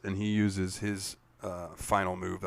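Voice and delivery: normal talking voice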